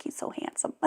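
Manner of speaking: very soft, whispery coo; hushed adoring gush. Retold or imitated. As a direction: whisper it like a smitten grandmother. Gushing over grandson's sonogram photos.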